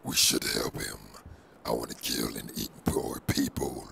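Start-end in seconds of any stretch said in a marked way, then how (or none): 1.7-3.9 whispered symbiote voice; breathy whispered monster; Venom whisper. Acted. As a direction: rasp it out as the creature in his head.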